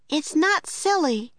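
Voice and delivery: put-on young girl Annie. A narrator's reading. Annie corrects the woman, firm girlish voice. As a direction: insist like a determined kid.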